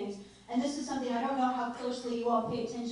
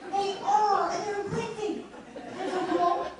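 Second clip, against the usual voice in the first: higher, mock-gushing answer to a child; playful excited reply; 9 semitones higher than usual. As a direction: play up the wonder for the kids. Mom answering kids playfully.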